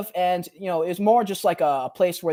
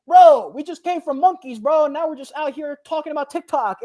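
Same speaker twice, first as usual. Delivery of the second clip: mocking disbelief; 8 semitones higher, 5 dB louder than usual. Mocking the monkey theory.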